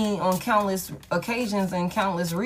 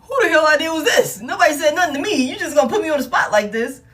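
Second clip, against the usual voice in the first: high, loud, laughing mock outrage; laughing protest; 8 semitones higher, 5 dB louder than usual. Real, not acted. Reader protesting to her spirit guides, laughing and loud.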